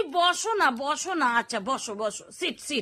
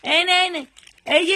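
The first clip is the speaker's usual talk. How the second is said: ordinary delivery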